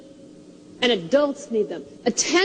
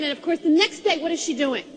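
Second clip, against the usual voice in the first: ordinary delivery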